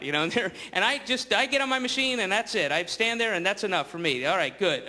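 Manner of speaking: ordinary talk, nothing marked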